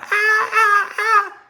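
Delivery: high crying screams; mock screaming. His mother's screams, a high frightened cry. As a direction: scream like a terrified mom.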